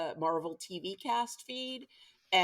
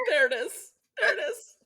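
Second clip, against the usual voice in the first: laughing; 10 semitones higher, 6 dB louder than usual. Real, not acted. Teasing a guest's young age.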